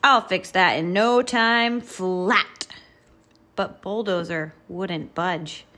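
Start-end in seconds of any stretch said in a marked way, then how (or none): 0.0-2.7 loud, higher boast; roaring roller truck. A narrator's reading. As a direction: throw it out big and brash. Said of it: "roared Roller".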